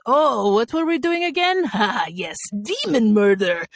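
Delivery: theatrical Eastern European accent, pitched high; excited roving fortune-teller in a throaty Eastern European accent; Masha, Eastern European accent. Shown as a demonstration. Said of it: "signature phrase was".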